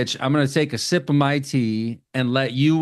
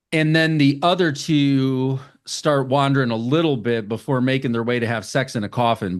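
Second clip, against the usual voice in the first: ordinary talk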